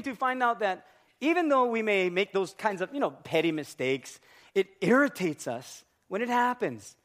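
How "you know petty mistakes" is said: ordinary talk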